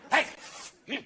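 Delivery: mock drunk picking a fight; slurry bar-tough bravado; lightly voiced angry drunk; belligerent drunk. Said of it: "See those guys going".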